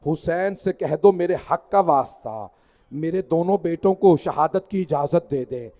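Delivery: chanted mourning recital, full voice; majlis recitation. Acted. Reciter mourning the martyrs before the congregation, chanted lament.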